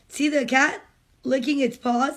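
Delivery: usual speaking voice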